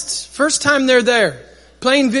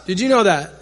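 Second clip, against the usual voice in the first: ordinary delivery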